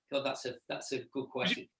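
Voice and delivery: normal talking voice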